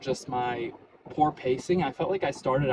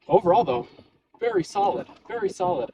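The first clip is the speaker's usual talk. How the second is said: excited; 6 semitones higher, 5 dB louder than usual. Real, not acted. Pleased with his run result.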